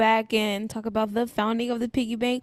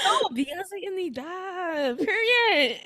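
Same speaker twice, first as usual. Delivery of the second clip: starstruck fan; 7 semitones higher, much slower than usual. Retold or imitated. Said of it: "they're gonna be like".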